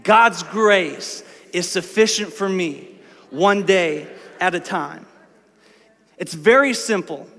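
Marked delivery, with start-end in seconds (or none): 0.0-5.1 slow, word by word, leading the room; slow call-and-response read; slow recital with the congregation. Shown as a demonstration. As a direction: say it slowly so they can join. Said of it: "Say that with me".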